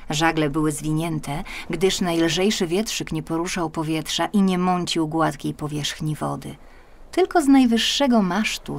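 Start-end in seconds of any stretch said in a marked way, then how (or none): none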